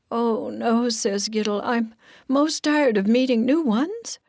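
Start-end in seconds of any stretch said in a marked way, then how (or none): 0.1-0.9 higher-pitched mild dismay; lightly voiced reluctant character; protesting Giddle. 1.6-4.2 high, loud complaint; reluctant character, voice raised; protesting Giddle. Acted.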